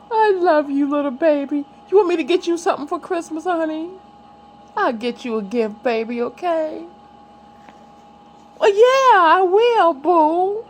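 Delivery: high cooing to her little one. Real, not acted. Talking tenderly to her baby.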